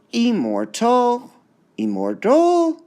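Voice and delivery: "Immortal" wrongly stressed as im-mor-TAL, ending very high, loud and stretched; stress on last syllable. Shown as a demonstration. Teacher tests a wrong option, stress on TAL in "Immortal". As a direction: jump way up on TAL in "Immortal", slowly. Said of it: "Which part would be stressed?".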